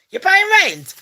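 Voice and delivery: insist, rising in pitch; high indignant second go. Acted. Telling off ghost, repeated.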